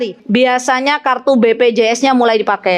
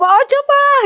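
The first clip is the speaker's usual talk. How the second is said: high mock plea; 13 semitones higher, slower than usual. Wife pleading with husband.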